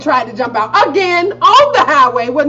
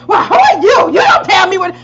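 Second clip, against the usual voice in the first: louder, higher, mild mock indignation; indignant mother; 7 semitones higher, 6 dB louder than usual. Mom snapping at daughter in the car. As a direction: bristle like an offended mom. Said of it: "mother snaps back".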